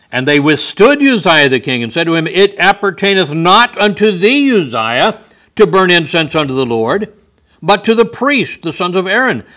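preaching scripture. Acted. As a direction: thunder the priests' rebuke. Reads scripture at full force.

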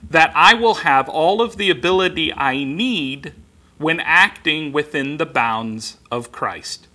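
ordinary delivery

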